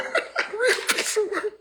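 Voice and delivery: high, slow words broken by laughter; laughing through it. Real, not acted. Streamer mocking a viewer, laughing words. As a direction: laugh out the insult.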